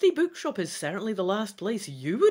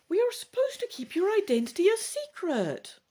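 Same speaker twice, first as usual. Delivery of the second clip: plain reading voice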